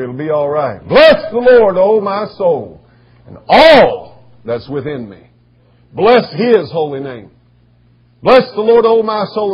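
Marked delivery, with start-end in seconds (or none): 0.8-7.3 loud, slow, drawn-out praise with ALL held long; louder praise. Shown as a demonstration. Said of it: "kinda get a little louder". 8.2-9.5 loud, high-pitched praise; louder praise. Shown as a demonstration. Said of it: "kinda get a little louder".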